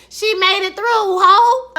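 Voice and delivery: high, loud cheer. Real, not acted. Viewer celebrating Emily, high loud cheer. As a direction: cheer her through.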